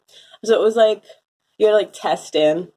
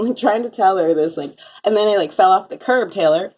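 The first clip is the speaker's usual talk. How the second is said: ordinary talk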